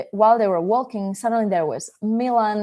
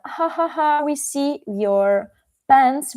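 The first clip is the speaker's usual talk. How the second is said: staged ha-ha taunt, pitched up; acted jeering laugh, slowing into the taunt; mocking laughter; 5 semitones higher, slower than usual. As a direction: tease like laughing kids, drawing out the words. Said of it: "the kids start laughing so much".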